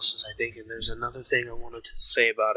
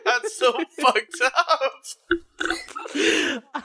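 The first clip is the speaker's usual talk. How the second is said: laughing; 13 semitones higher than usual. Real, not acted. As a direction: talk while still laughing. Laughing at cohost's joke.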